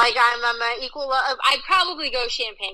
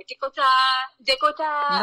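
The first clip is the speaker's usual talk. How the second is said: put-on French-accented name, pitched up; imitated French accent; 2 semitones higher than usual. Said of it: "every French chef I've ever worked for".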